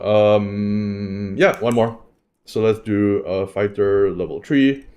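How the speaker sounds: usual speaking voice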